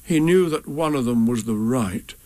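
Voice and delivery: ordinary delivery, nothing marked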